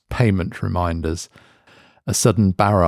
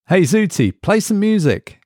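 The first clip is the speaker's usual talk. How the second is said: Mark's voice pitched higher, calling again; impatient call; 11 semitones higher than usual. A narrator's reading. Mark repeats command to device.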